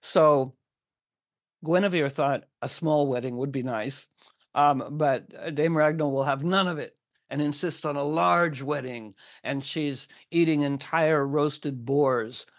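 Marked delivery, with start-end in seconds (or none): none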